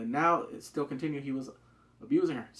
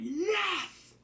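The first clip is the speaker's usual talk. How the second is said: excited cheering. Retold or imitated. Fan cheering at the drama's big moment, excited high cheer. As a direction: shriek with delight. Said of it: "I was like".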